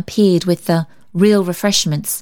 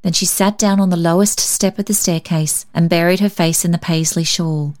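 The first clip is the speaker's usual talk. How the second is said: ordinary delivery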